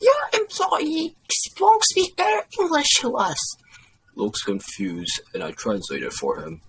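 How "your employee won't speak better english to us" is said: high, loud complaint; complaining mother. A narrator's reading. Mother complains to the boss about waiter, high and indignant.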